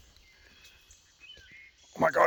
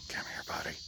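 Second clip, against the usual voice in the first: breathy hushed call; gentle whispered come-here; coaxing whisper; 16 dB quieter, faster than usual. Real, not acted. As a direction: whisper softly to lure the pet.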